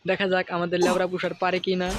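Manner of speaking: ordinary delivery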